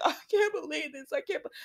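high, whiny mock of the girl; mocking Steffi. Host mocks Steffi's disbelief in a high, whiny voice. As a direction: poke fun at her disbelief. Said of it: "Stop making fun of this girl".

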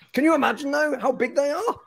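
high-pitched delighted wonder; gushing excitement. Real, not acted. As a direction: gush in a high, giddy voice. Gushing over a giant pet griffin.

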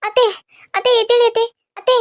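urgent, hurried cries to wake her; panicked calling. Acted. Daughter-in-law begs fainted mother-in-law to wake, panicked. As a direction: shake her awake in a panic.

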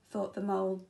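plain reading voice

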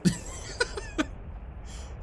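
a high airless giggle; high breathy laugh. Real, not acted. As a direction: let out a breathy laugh of disbelief. Laughing at game.

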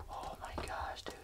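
breathless awed whisper. Real, not acted. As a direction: breathe it out in awe.

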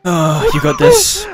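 high slow shout of encouragement; excited cheering. Real, not acted. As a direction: cheer them on, laughing. Cheering friend on.

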